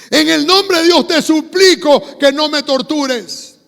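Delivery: loud, high, slow plea; demon pleading. Retold or imitated. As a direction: beg loudly as the demon, drawing the words out. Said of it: "cómo ora el demonio". (súplica del demonio a gritos)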